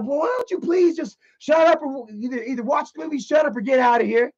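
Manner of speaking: high, scolding woman voice; imitated fed-up woman. Second woman turns to scold the heckler, mock fed-up. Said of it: "another female, she turns around".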